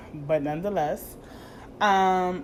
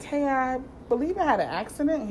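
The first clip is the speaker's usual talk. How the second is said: mock polite voice; 8 semitones higher than usual. Overly polite voice to the police.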